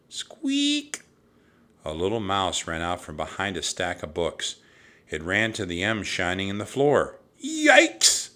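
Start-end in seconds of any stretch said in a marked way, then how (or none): 0.1-1.0 very high, tiny squeak; mouse squeak. A narrator's reading. Narrator imitates the mouse. 7.4-8.3 high, loud yelp; startled yelp. A narrator's reading. Annie startled by the mouse.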